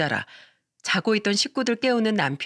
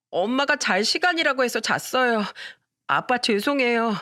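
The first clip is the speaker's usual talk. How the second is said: put-on child voice; 4 semitones higher than usual. Child apologizing to father.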